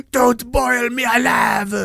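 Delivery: high, slow, put-on French accent. Lobster begging not to be boiled, hammy French accent. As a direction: plead in a hammed-up French accent. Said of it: "he's got a French accent".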